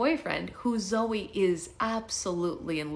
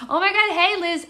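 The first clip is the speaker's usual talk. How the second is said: high, loud happy hello; excited greeting; 13 semitones higher, 10 dB louder than usual. Real, not acted. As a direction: squeal a surprised hello.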